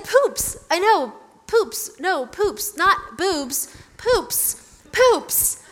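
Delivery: high-pitched, exasperated one-word corrections; frantic correcting. Retold or imitated. Reading out frantic failed text corrections.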